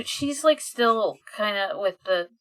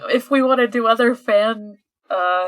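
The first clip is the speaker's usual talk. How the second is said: higher and much louder, laughing through it; laughing self-quote; 3 semitones higher, 8 dB louder than usual. Retold or imitated. Recalling her reaction to forty-five issues, loud laughing quote. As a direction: blurt it out, half laughing at the number. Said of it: "I was like".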